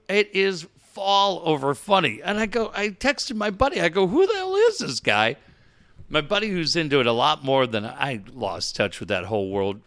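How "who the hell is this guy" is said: high-pitched incredulous question; retold astonishment. Host retelling his text to a buddy about the impersonator, astonished. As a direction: re-enact your baffled question, pitch leaping up.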